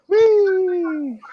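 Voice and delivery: high, long drawn-out "wheee"; playful whee. Pretend. Mower operator setting off for a ride, gleeful high squeal.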